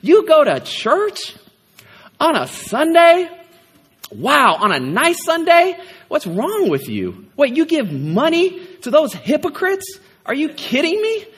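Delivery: incredulous high voice, mocking the churchgoer; mocking skeptical neighbor. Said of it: "The world looks at you and says".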